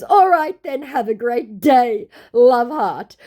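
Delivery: butter him up in a silly high voice; scammer flattering. Retold or imitated. Scammer flattering the vlogger, high and loud. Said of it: "he goes".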